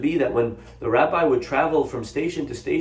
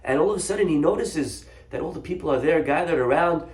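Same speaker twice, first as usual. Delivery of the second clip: ordinary delivery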